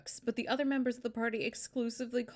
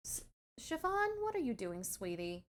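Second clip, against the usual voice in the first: much softer, tender aside; soft to the pet; 9 dB quieter than usual. Real, not acted. Owner softly cooing to her pet behind her. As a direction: coo gently at the pet behind you.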